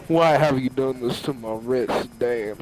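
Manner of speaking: low, put-on voice of reproach; car driver's voice. Retelling the driver staring down the passenger, low voice. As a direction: ask it low, in character. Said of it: "and he, he asks".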